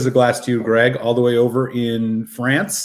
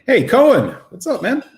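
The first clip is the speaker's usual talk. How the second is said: bright-pitched, high hello to a pal; real excited high-pitched welcome; excited greeting; 11 semitones higher, 4 dB louder than usual. Real, not acted. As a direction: call out happily as a friend turns up. Greets a viewer just arriving.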